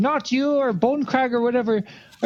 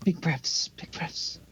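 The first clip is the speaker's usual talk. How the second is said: calming whisper; 6 semitones lower, 8 dB quieter, much slower than usual. Acted. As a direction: whisper softly, big breaths. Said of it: "calming Daigle down".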